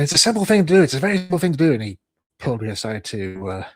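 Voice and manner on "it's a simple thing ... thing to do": louder, higher mock telling-off; retold shouting. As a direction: bark it at him like an angry boss. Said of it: "shouted at me, saying".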